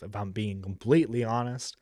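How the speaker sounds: ordinary talk, nothing marked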